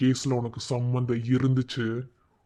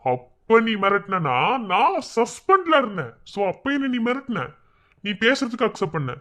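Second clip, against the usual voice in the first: heated confrontation; 6 semitones higher than usual. Acted. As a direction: throw the old threat back, voice raised high. Policeman confronts suspect over past threat. (குரலை உயர்த்தி சீறும் தொனியில்)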